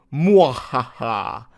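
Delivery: slow voiced evil cackle; evil laugh. Reading a forum boast aloud, voiced evil laugh. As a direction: let a hammy villain laugh roll out. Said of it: "Evil emoji".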